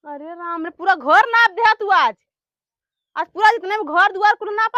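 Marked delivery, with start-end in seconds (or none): none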